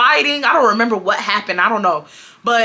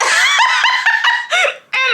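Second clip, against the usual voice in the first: burst of laughter. Real, not acted. Speaker laughs.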